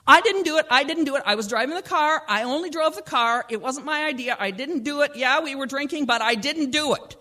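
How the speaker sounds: high, loud frantic insistence; panicked denial. Acted. Zach cutting her off, frantically denying it. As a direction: blurt it out defensively.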